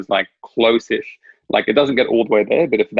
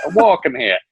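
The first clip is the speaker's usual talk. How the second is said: half-convincing New York accent, pitched up; 6 semitones higher than usual. Shown as a demonstration. Joking attempt at a famous line, shaky grasp of a New York accent. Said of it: "can't even do a New York accent".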